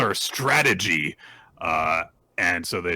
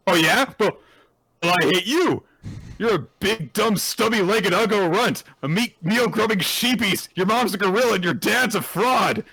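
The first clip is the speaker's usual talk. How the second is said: put-on high, loud bratty boy shouting insults; mock angry kid yell; 13 semitones higher, 5 dB louder than usual. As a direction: yell like a furious little rich kid. Damien insulting Anya.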